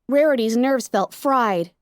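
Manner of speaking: ordinary delivery, nothing marked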